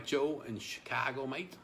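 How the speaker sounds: drawn-out Aussie twang on the greeting; playful Australian accent greeting a caller. Pretend. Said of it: "That was an Aussie accent".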